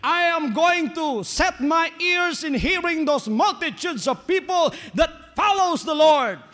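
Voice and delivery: lightly voiced pleading beggar; a hint of the blind man's hope; voicing the blind man. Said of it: "He said".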